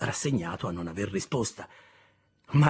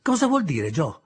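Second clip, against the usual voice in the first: put-on high, louder child's question; curious boy asking, much higher; eager boy's question; 6 semitones higher, 7 dB louder than usual. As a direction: press Joe for an answer. Pip presses Joe.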